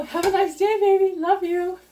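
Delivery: high, sugary sing-song farewell; sweetly teasing. Retold or imitated. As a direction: coo it sweetly, like teasing a grumpy husband. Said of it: "Say it lovingly".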